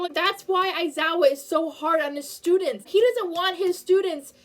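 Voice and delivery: normal talking voice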